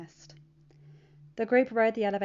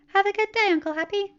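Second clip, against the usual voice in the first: put-on high, louder, bright-eyed teen voice; cheerful teen; 12 semitones higher, 9 dB louder than usual. Teen telling his uncle about his weekend, cheerful and high. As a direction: bounce through it like an eager kid.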